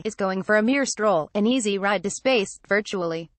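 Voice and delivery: ordinary talk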